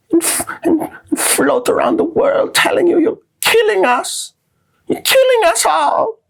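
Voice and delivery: very high, mocking falsetto; hammed-up young activist scolding, drawn out; slow, strained falsetto plea in mockery; Greta Thunberg. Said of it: "That's how she sounds".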